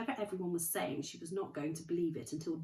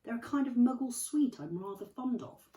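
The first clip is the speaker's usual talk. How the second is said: airy Dumbledore; 8 semitones higher, 6 dB louder than usual. Acted.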